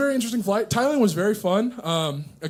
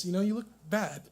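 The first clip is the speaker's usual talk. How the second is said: ordinary talk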